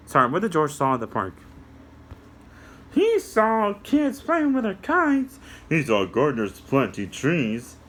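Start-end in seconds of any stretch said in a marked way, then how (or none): 2.9-5.4 high squeaky puppet, slow; a little puppet explaining to kids; high cartoon helper voice. Puppet answers.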